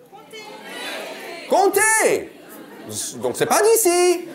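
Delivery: high, outraged "Comté!"; mock shock. Real, not acted. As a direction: feign horror at a foreign cheese. Mock shock at regional cheese. (faux scandale aigu sur « Comté! »)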